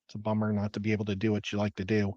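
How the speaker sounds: ordinary delivery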